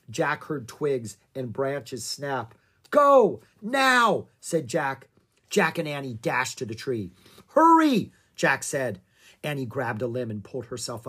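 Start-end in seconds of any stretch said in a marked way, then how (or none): 2.9-4.3 loud, high-pitched urgent command; urgent call. A narrator's reading. Jack sends Annie dashing from the shaking bushes, urgent call. Jack urges escape to tree. 7.5-8.1 high, loud urging cry; urgent call. A narrator's reading. Jack urges Annie up the tree from danger, urgent call.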